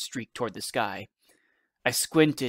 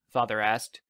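usual speaking voice

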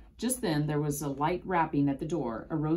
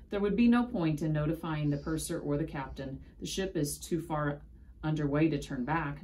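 ordinary delivery, nothing marked

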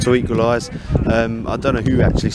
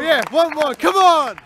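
yelling from the sidelines; 13 semitones higher than usual. Real, not acted. Fan cheering the equaliser.

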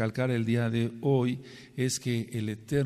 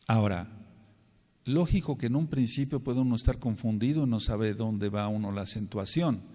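normal talking voice

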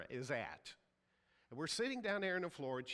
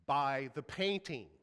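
slow, louder, gracious but firm; gracious command; 8 dB louder, slower than usual. Retold or imitated. The Holy Spirit telling the pastor, slow and firmly gracious. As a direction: lay it down slowly like a kind final word. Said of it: "Holy Spirit very graciously says".

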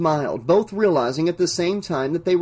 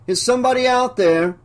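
higher, drugged-sounding sing-song call; distracted sing-song; 8 semitones higher, 4 dB louder than usual. Dazed banker calls from the vault, higher sing-song. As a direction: lilt it dreamily like he's drugged. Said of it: "He sounded distracted, sing-songy, almost drugged".

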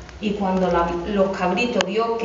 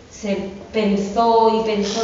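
usual speaking voice

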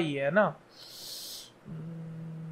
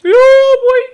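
yelling taunt; 15 dB louder, much slower than usual. Real, not acted. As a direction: bellow it out to the enemy. (ऊँची ज़ोरदार ललकार)

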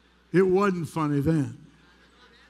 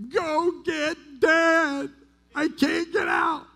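high, slow, desperate call for help; a scared kid stuck in a chimney calling his sister; panicked child's cry; 11 semitones higher, 3 dB louder, slower than usual. Retold or imitated. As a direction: yell for dad like a frightened little boy. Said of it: "And I screamed to my sister".

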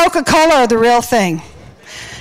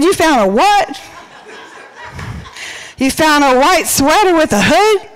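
higher, incredulous question; mock disbelief; 3 semitones higher than usual. Friend reacting to news of a new dude, mock disbelief.

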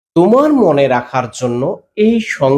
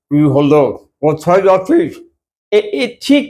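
mild imitation of an elderly person with missing teeth; a hint of an old man speaking with air slipping past lost teeth; toothless old person. Teacher imitating a toothless elder for students, lightly. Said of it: "বয়স্করা এই ধরনের কথা বলে থাকে".

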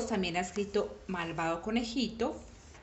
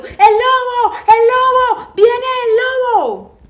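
very high, loud repeated alarm; frantic yells at full voice; shouting; 14 semitones higher, 14 dB louder than usual. A narrator's reading. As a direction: scream it as if running to warn the village. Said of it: "corrió al pueblo gritando".

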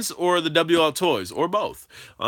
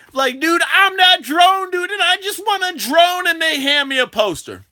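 much higher, louder excited rant; excited retelling; 14 semitones higher, 6 dB louder than usual. Real, not acted. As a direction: build up the outrage.

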